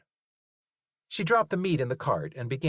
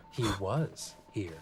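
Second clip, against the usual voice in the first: softer, slow; quiet realization; 2 semitones lower, 5 dB quieter, slower than usual. A narrator's reading. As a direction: let it land softly.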